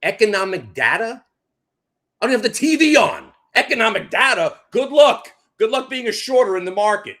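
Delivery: loud, high scoffing taunt; mocking disbelief. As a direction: sneer at the shorters. Mocking the shorters.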